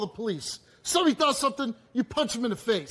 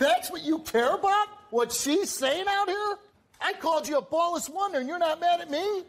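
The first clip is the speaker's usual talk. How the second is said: pitched up, needling disbelief; mocking disbelief to rile him up; incredulous challenge; 7 semitones higher than usual. Real, not acted. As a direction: goad him with raised pitch. Host goading angry father.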